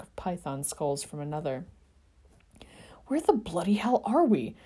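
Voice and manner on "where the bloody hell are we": through gritted teeth. A narrator's reading. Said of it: "through gritted teeth".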